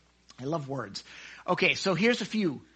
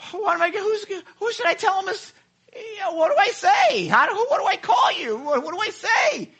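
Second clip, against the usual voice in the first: flustered Moses; 13 semitones higher, 4 dB louder than usual. Acted. Acts out Moses flustered at God.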